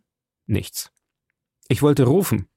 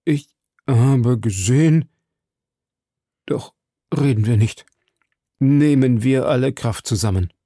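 stammering, exhausted. A narrator's reading. Exhausted servant urging his master on, halting. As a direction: push the words out in broken pieces. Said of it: "stammelte er".

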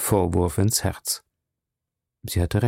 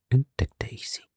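whisper; 7 dB quieter than usual. A narrator's reading. Heimliches Wiedersehen, erzählt.

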